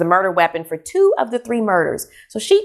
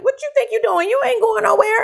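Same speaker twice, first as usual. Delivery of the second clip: much higher-pitched mock scolding; indignant confrontation; 13 semitones higher than usual. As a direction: imitate a sister catching someone sneaking out. Sister catches her running away, confronts her.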